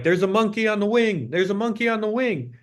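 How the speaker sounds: high-pitched yell held back in volume; mock panicked scream. Acting out an improv comic at the plane window, mock screaming. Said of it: "he was screaming, like".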